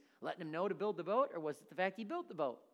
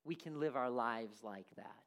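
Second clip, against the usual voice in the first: ordinary talk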